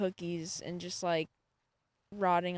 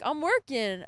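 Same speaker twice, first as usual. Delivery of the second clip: raised-pitch, matter-of-fact retold answer; retold reply; 6 semitones higher, 4 dB louder than usual. Student answering professor in lab, mild retold reply. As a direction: answer the professor without pushing it.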